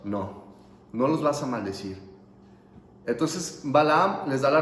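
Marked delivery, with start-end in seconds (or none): 0.0-2.0 lightly voiced divine refusal, low; a low, softer, final no; Dios. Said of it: "Y le dice Dios".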